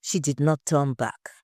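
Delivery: ordinary delivery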